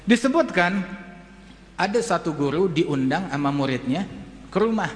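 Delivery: normal talking voice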